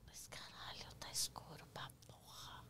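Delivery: hushed scared whisper in the dark. Retold or imitated. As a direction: breathe it out, barely voiced. Power cut, dark morgue.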